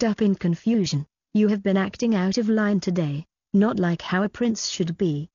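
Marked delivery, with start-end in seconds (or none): none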